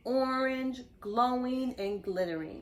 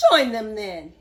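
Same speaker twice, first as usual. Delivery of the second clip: snarling lobster; 2 semitones lower, 8 dB louder than usual. Acted. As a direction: snarl it dismissively. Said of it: "snarled the lobster".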